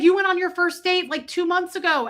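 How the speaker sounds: high, loud and eager; excited recognition. Retold or imitated. Shopper to the grocery bagger about her first date, excited. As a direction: burst out with excited energy. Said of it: "because I was all excited".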